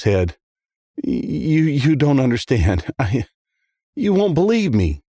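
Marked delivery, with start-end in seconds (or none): 1.0-5.0 halting, higher-pitched, upset; choked up. A narrator's reading. Kid resists confessing to the detective, choked up. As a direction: stammer it out, close to tears. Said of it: "He started to choke up".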